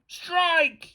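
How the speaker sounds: high, drawn-out sporting yell; excited high call. A narrator's reading. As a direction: yell it like a kid calling a game. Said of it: "yelled a third".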